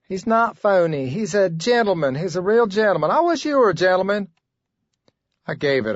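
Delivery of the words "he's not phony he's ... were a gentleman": higher, indignant woman; girlfriend's voice. Acted. Clerk defending the gentleman to her boyfriend, higher indignant voice. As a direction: defend him high and indignant.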